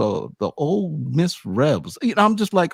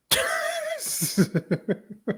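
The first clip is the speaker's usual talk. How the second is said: high laugh; 5 dB quieter than usual. Real, not acted. Listener laughs at joke.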